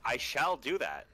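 mock alien voice. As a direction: ham up a stilted alien trying to sound human. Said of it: "talk like a alien trying to be a human".

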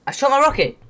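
high, loud insistence; insistent protest. Real, not acted. Gamer disputes with friend over rocket, insistent yell.

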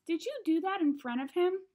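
put-on stern-ish mother checking on her child; getting serious. A narrator's reading. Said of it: "getting serious".

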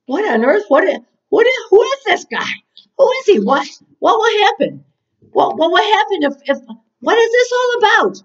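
high, stammering disbelief; acted astonishment. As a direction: stumble over bewildered questions, pitch jumping up. Acting David's disbelief at Goliath.

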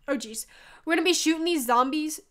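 normal talking voice

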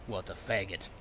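soft, resentful grumble; quiet, bitter little jabs; muttered cursing. Pretend. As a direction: curse the foe under your breath. Cursing the enemy under his breath.

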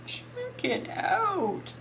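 very slow, high-pitched show-off line; drawn-out admiration. Real, not acted. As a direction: stretch CHECK IT OUT right out, pitched high.

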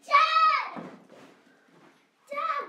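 loud desperate call; yelling for dad. Acted. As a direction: shout for dad to wake up. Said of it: "So let's go".

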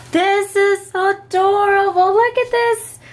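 excited squeal. Real, not acted. Seeing her friend's handmade flip book, high excited squeal. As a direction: squeal over how adorable it is.

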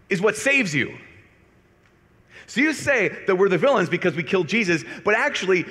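imitated indignant challenge, pitched high; really mad, ramped up. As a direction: build a worked-up accusation, pausing between phrases. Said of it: "he was really mad. He was really ramped up".

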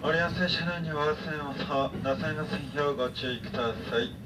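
ordinary talk, nothing marked

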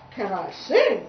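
loud, incredulous frog voice; boastful frog. Acted. Frog asked whether he sings, loud and boastful. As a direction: throw the question back proudly.

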